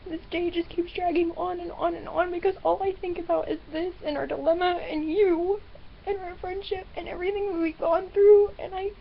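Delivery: upset voice rising with tears; crying. Real, not acted. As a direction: talk on through the sobs. Crying about the friendship.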